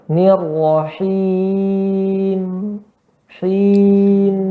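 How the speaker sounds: "Nirrahim" drawn out slowly, the final m hummed long; "Him" held with a ringing nasal m; flowing mim. Shown as a demonstration. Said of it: "mim sifatnya nanti dia mengalir suaranya".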